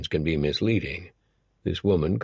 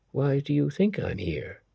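Samandi's voice; 8 semitones higher than usual. A narrator's reading. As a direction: gently voice her. Samandi answers gently.

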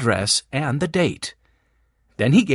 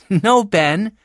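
high, louder, with a touch of laughter; amused protest; 6 semitones higher, 5 dB louder than usual. A narrator's reading. Jessie corrects Ben, mildly amused. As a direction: lightly laugh off the teasing. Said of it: "Jessie laughed".

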